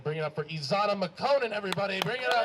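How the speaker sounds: loud, building announcer call; rousing stage introduction. Real, not acted. Host bringing up the last comedian, rousing and loud. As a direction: whip up the crowd for the next act.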